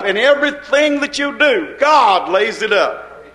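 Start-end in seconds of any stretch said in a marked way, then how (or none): none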